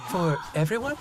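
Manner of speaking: Zoe, haggling with the butcher; Zoe's voice. A narrator's reading. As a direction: brush it off as Zoe.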